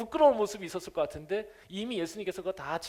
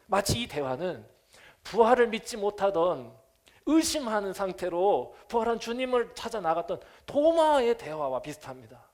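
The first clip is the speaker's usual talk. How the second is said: ordinary talk, nothing marked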